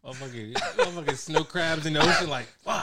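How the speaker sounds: cracking up, loud laughing shout; bursting with laughter mid-sentence. Real, not acted. Laughing at crab joke.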